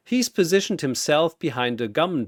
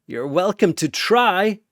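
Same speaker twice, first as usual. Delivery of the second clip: a higher, louder defiant snarl; 6 semitones higher, 4 dB louder than usual. A narrator's reading. Korra snarls at Mayuri, louder and higher. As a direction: spit the challenge back. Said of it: "Korra snarls".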